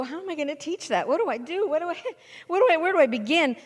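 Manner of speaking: flustered, rushing panicky questions; confused new teacher. Retold or imitated. New teacher facing the student page, mock panic. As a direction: act the overwhelmed novice. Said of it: "and you go".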